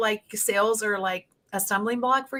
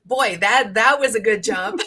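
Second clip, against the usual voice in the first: laughing; 5 semitones higher, 4 dB louder than usual. Real, not acted. Laughing at the joke.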